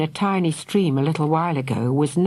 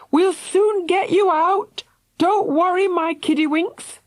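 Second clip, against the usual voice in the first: put-on calling to children. A narrator's reading. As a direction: call down comfortingly. Parent calling through cellar door.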